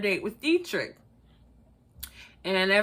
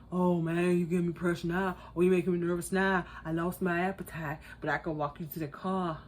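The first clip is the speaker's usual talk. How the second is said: mocking nervous date; 6 semitones lower, 7 dB quieter than usual. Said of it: "he was being really weird".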